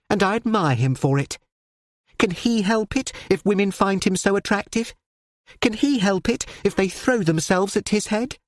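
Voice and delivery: earnest daughter explaining to her father; young daughter's voice. Acted.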